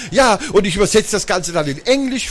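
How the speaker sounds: high-pitched, enthusiastic chiming in; eager girl. A narrator's reading. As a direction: add your idea to your brother's plan eagerly. Magda eagerly adds to the plan.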